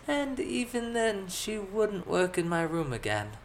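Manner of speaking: put-on high, drawn-out girlish confession; embarrassed young noblewoman. A narrator's reading. Elizabeth confessing a mishap to companions, embarrassed high voice.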